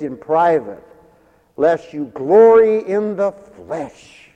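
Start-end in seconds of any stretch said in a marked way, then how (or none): none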